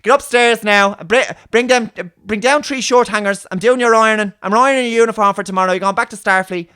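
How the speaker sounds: loud, high Dublin scolding; strong Dublin accent. Retold or imitated. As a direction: yell orders up the house in thick Dublin. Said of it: "a strong Dublin accent".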